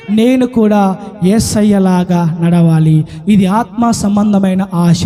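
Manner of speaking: low, slow, weighty preacher's voice; solemn longing. Retold or imitated. Peter resolving to walk like Jesus, low and solemn. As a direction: drop deep and draw out each word.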